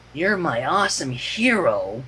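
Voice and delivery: slow, distant dreamy drone; weird faraway drone. A narrator's reading. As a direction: drone it like someone hollowed out inside. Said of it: "droned in a weird, faraway voice".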